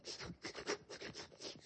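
soft, voiceless crying gasps; imitated child's sobs. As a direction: mimic a little girl's sniffling sobs. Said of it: "she's crying".

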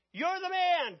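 high, loud cry of accusation; cried accusation. Acted. Prophet Nathan crying out at King David, high and loud. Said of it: "cried Nathan".

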